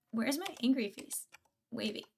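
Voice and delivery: ordinary delivery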